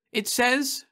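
put-on high young woman reading; Florence's voice. A narrator's reading. Wife reading from the encyclopedia, high voiced. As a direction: announce it in a high voice.

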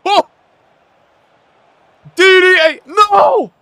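loud, high yell of despair; frustrated shout. Real, not acted. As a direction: shout at the screen. Reacts to a mistake in game.

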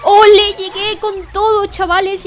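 high, loud victory cheer; a loud, high, triumphant gamer cheer; triumphant shout. Real, not acted. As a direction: celebrate the win at full voice. Gamer clears the level.

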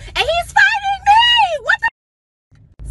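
drawn-out high shriek of disbelief; very high, loud, slow wail; outraged shout. Retold or imitated. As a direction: scream it in comic outrage. Shouting in outrage retelling struggle.

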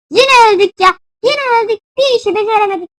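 high, loud whine of defeat; exasperated complaint. Real, not acted. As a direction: complain loudly after losing. Complains after dying again. (yüksek sesle yakınarak)

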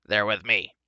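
loud, curt dwarf retort; snapped. A narrator's reading. Dwarf vouches for humans, snapping. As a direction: snap it out firmly. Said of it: "snapped the dwarf".

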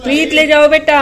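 loud call across the house. Real, not acted. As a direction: call her from the next room. Calls Preet across house.

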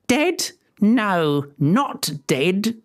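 put-on high, icy old witch voice; fairy mocks the queen's guess, coldly; cold crone Griselda. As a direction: cut her off and sneer it. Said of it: "Griselda said coldly".

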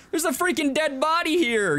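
startled yelp of disbelief; shocked. Real, not acted. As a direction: blurt out the shock of finding a corpse. Finds a body in game.

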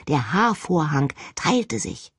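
ordinary delivery

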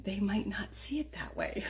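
conspiratorial whispered warning; a wry whispered aside to viewers. Acted. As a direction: whisper it like a secret. Aside after "doing everybody a favor.

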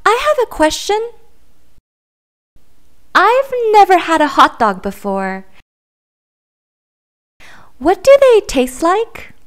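small, high, wondering duckling voice; curious duckling. Acted. Duckling politely questioning the pigeon, in a high curious voice. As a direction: ask sweetly, wide-eyed and innocent.

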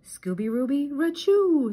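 high dog voice into sneeze; sneezing. A narrator's reading. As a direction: say his name, then sneeze loudly. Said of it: "sneezed Scooby".

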